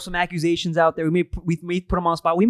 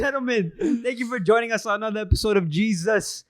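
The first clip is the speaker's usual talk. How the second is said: announcer voice; 5 semitones higher than usual. Acted. Podcast intro announcement.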